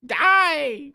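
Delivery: high, loud, squeaky creature rant; a sneering butterfly screeching at a worm; shrill butterfly voice. Acted. Butterfly screeching insults down at a fat caterpillar.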